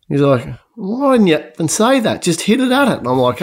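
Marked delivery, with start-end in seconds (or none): 0.7-3.0 high, incredulous complaint; why didn't you just say so; exasperated. Retold or imitated. Golfer exasperated at caddie.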